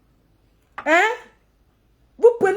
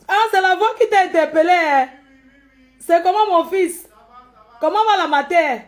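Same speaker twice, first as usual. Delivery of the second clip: calling out greetings; 3 semitones higher, 4 dB louder than usual. Real, not acted. Vlogger asks "mon fils" how he is, calling out greetings. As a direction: call over to an acquaintance. Calls out greetings to someone nearby.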